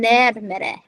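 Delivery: "närmare" with open Nordic ä; Nordic vowel example. Shown as a demonstration. Non-native showing tricky vowels, "närmare" in Nordic pronunciation. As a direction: sound out "närmare" as a Nordic word. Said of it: "there's so many E's".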